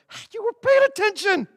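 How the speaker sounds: high, bright hey to the youth; pleased surprise. Retold or imitated. Pastor praising the youth for noticing, delighted high voice. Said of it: "I was like".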